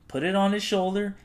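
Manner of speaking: usual speaking voice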